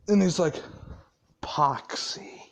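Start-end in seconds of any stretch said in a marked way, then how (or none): 1.4-2.4 low, hushed summons; menacing acted whisper. Boss summoning terrified Pox, menacing acted whisper. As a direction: call the underling under your breath.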